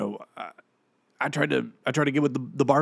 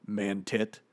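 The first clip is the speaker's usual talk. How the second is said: ordinary talk, nothing marked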